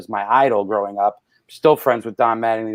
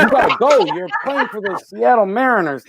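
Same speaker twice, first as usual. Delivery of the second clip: high-pitched incredulous retort; mock indignation; 8 semitones higher than usual. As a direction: exclaim it in mock disbelief at a friend. Acting out his reply to Griffey.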